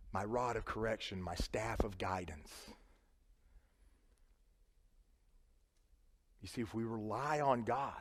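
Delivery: tender quiet preaching; hushed intimacy. Acted. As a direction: lean in and speak softly. Sudden hush after climax.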